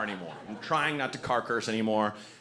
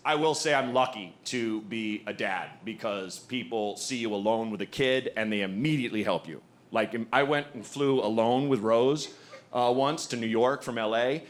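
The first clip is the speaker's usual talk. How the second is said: ordinary talk, nothing marked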